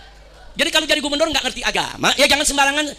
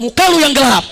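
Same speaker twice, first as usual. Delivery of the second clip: louder imitated coffee-stall comeback; mock retort; 3 semitones higher, 7 dB louder than usual. Friend answering back at the coffee stall, loud mock retort. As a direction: throw it back forcefully at a mate.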